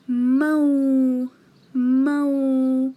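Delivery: "mau" said loud with the w cut short, a clear stop after it; sukoon pause. Shown as a demonstration. Teacher showing pupils how "mau" stops on the sukoon. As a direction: hold "mau" then stop dead on the sukoon. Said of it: "It's when I pause. Like I say".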